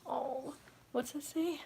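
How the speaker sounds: ordinary talk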